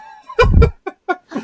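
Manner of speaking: high wheezing laugh; can't stop laughing; helpless laughter. Real, not acted.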